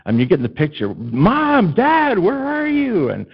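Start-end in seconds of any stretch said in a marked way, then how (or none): 0.9-3.1 high, drawn-out cry for lost parents; imitating a lost kid calling out; retold child's scream. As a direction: wail for mom and dad, stretching the words. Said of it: "and I'm screaming".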